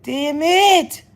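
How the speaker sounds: high, loud frustrated cry; loud frustrated yelp. Retold or imitated. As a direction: shriek the curse in annoyance. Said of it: "I said".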